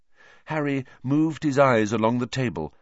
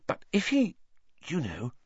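put-on girl voice; 7 semitones higher, 4 dB quieter than usual. A narrator's reading. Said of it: "Hermione dropped her voice".

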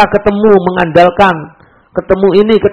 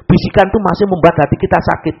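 ordinary delivery

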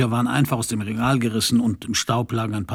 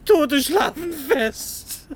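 high, slow, amused delivery; laughing quip; much slower than usual. Acted. Burma quips to Bellita about the dead, laughing. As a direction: drag out the joke laughing.

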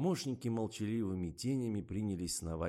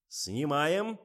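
high, raised director's call; loud command; 8 semitones higher, 8 dB louder than usual. A narrator's reading. Director commands the crew to shoot, loudly. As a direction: give the order to the whole set. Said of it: "скомандовал Фомин".

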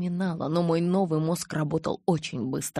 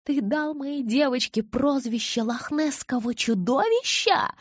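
high, slow, outraged screech; incensed, high-pitched outcry; shrieking; 9 semitones higher, 3 dB louder than usual. A narrator's reading. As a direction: shriek it in disbelief, drawn out. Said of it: "взвизгнула я".

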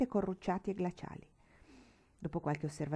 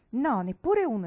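put-on louder, higher boyish exclamation; defiant boy's shout, louder and higher; exclaiming boy; 6 semitones higher, 8 dB louder than usual. A narrator's reading. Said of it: "esclamò Tom".

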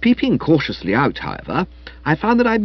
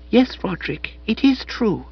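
put-on higher, softer soulful girl; Madeline's voice; 9 semitones higher, 4 dB quieter than usual. A narrator's reading. Madeline confessing the engagement to Spode, high and soft.

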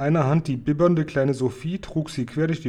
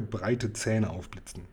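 ordinary delivery

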